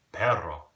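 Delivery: "perro" loud, with a trilled rr; rolled Spanish R. Shown as a demonstration. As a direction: roll the rr hard in "perro". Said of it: "notice how it's like the r".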